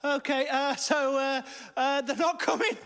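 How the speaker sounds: high-pitched, hammed-up wail; tearful panic. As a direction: start hesitant, build to a wailing meltdown. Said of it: "Stop crying".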